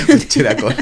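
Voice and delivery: chuckling through the words; laughing. Real, not acted. Amused repeat of drug name.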